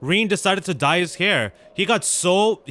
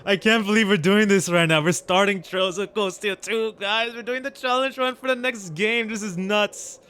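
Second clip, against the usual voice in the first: excited; 4 semitones higher than usual. Real, not acted. Starting the new game's challenge run.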